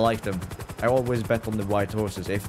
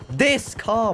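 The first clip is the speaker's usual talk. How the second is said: THIS CAR punched out much higher; insistent stress; 11 semitones higher, 4 dB louder than usual. Real, not acted. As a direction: hit "This car" high and insistent. Insisting on which car to scan.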